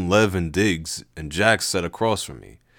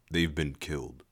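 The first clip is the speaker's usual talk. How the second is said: grim; 4 semitones lower, 7 dB quieter than usual. Acted. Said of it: "I finished grimly".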